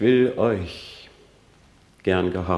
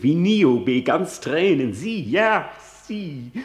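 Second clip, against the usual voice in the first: bitter outcry; 7 semitones higher, 4 dB louder than usual. Acted. Soliloquy, bitter outrage.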